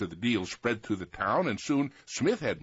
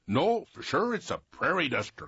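higher, louder insistent cry; cried out; 5 semitones higher, 4 dB louder than usual. Acted. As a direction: shout a correction. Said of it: "cried another".

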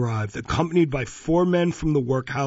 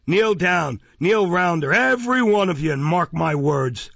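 higher, pleading through tears; tearful raised plea; 5 semitones higher, 4 dB louder than usual. A narrator's reading. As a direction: cry it out to them all. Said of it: "bursting into tears".

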